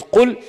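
"Qul" said high and loud; "Qul" jumps up to grab attention; stressed word. Shown as a demonstration. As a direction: lift "Qul" way up. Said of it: "to make something heard".

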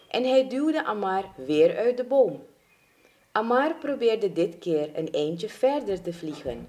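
plain reading voice

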